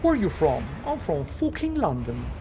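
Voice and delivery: a hammed-up thick British accent, not fully held; mock a Londoner in a rough British accent; light, playful British accent put on for the chat. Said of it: "pretty thick British accent".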